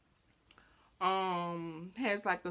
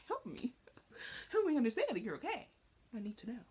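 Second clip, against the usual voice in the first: pitched-up, pleading, rushing the middle; anxious pleading; 5 semitones higher, much faster than usual. Retold or imitated. Mock-pleading with a friend who seems mad at her, high. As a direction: beg them to say what's wrong. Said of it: "And I'm like".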